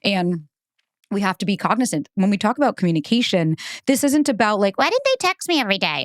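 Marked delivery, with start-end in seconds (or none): 4.8-6.0 high-pitched imitation of whining; mock complaint. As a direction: mimic someone fretting over texts. Mimicking needy complaint about texting.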